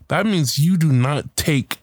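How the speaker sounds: ordinary delivery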